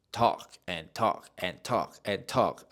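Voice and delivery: slow, evenly spaced repetition; plodding, endless-chatter delivery; droning on. Shown as a demonstration. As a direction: drag out each repeat, word by word. Acting out his endless talking.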